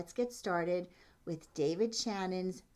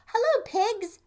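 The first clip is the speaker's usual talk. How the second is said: high, loud chirpy duck greeting; cheery duck; 9 dB louder than usual. Acted. Duck greets the cow, high and loud. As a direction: sing out hello like a happy duck.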